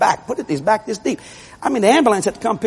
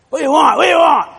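raised, high shouted reply; shouting back; 7 semitones higher, 4 dB louder, faster than usual. Retold or imitated. Fred hollers back when called, shouting. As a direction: imitate a kid hollering back.

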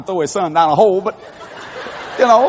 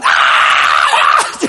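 loud mock scream at the top of the lungs; comic drawn-out yell of terror; imitated scream; 5 dB louder than usual. Fred screaming as he is stuffed down, mock scream. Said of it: "screaming from the top of his lungs".

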